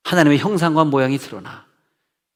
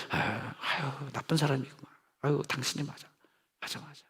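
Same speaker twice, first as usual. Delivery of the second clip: sympathetic counselor; 17 dB quieter than usual. Shown as a demonstration. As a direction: console a hurting person gently. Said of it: "하여튼".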